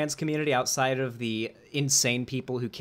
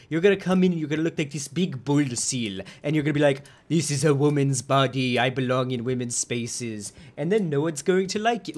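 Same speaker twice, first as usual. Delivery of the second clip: a lightly put-on thick British accent, raised a bit, mocking; 3 semitones higher, 4 dB louder than usual. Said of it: "just a disgusting British accent".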